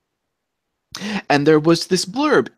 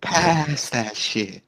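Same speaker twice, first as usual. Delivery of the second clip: slow lazy stoned drawl; stoner voice; 4 dB quieter, much slower than usual. Pretend. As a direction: talk like a baked stoner. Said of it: "Why do we all have a stoner voice?".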